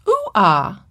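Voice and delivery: "Ooh-ah" for doggy, both vowels off, consonants gone; incorrect vowels. Retold or imitated. Teacher shows the child's error, "Ooh-ah" with wrong vowels. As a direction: give the mistaken "Ooh-ah" in bare vowels. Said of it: "he's using incorrect vowels".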